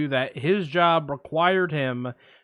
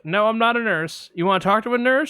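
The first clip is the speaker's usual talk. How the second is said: higher receptionist voice; mock receptionist, pitched up; 7 semitones higher, 4 dB louder than usual. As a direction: lift it up, helpful front desk. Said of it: "I'm gonna play both parts".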